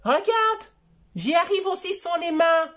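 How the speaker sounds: put-on high, whiny little boy voice; child voice. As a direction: plead like a small child. Voix de Choupi. (voix aiguë de petit garçon qui pleurniche)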